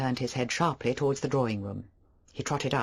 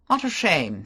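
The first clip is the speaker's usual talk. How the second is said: louder hearty visitor; 5 semitones higher, 7 dB louder, slower than usual. Acted. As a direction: say it heartily, full of bluff charm. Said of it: "he said heartily".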